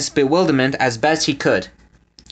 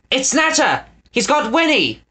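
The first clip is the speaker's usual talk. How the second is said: high alarmed cry; 10 semitones higher than usual. A narrator's reading. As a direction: call out in alarm. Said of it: "Eggs cried".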